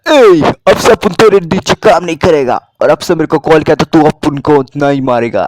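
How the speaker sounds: loud, high defiant yell; tough hero shout. Acted. Siddhu quitting on the phone to the gangster, loud defiant shout. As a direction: bark it down the phone at the boss. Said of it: "phone करके बोलता".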